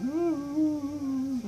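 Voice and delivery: high whimpering wail; whimpering people. Acted. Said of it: "they were all whimpering".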